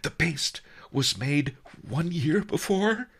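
high, anxious rising question; astonished merchant, pitch climbing to the end; gasping. A narrator's reading. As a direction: press the question breathlessly. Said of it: "he gasped".

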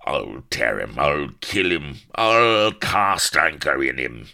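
raving pirate rage. A narrator's reading. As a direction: work up into a pirate's bellowed threat. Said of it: "He was starting to rave".

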